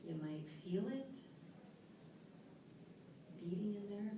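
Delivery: soft, unhurried relaxation guide voice; guided relaxation. Acted. Speaker to seminar audience, guiding a calming exercise. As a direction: ease the room into calm, softly.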